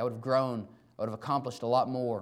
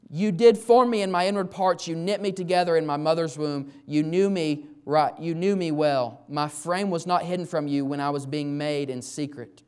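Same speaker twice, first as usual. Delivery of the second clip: loud, raised scripture recitation; proclaimed psalm; 3 semitones higher, 7 dB louder than usual. Acted. Pastor reading Psalms to the congregation, loud proclamation. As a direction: declare the psalm out to the room.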